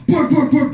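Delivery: high, loud mock barks; hammed-up barking dogs; imitated dog barking. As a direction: bark it out like an angry police dog. Police dogs barking in faces.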